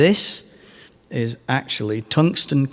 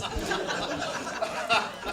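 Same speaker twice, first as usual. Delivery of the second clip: laugh; 9 semitones higher, 12 dB quieter than usual. Real, not acted. Laughing at the pricey slide.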